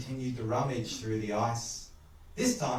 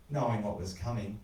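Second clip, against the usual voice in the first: usual speaking voice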